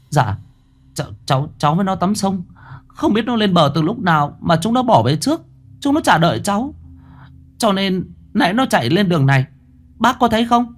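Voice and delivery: put-on flustered boy. Boy explaining to the old man, flustered and high. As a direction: stammer it out, unsure and worried. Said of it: "Tôi lúng túng chỉ".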